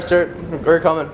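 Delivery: usual speaking voice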